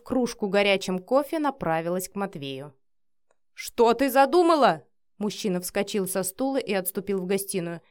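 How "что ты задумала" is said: loud, higher anxious demand; nervous outburst; alarmed. A narrator's reading. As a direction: demand it in sudden worry. Alarmed man backs away from wife.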